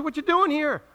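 God's sharp question. Retold or imitated. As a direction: fire the question up high and fast.

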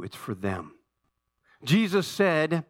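ordinary delivery

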